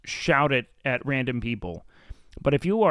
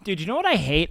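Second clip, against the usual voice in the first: ordinary delivery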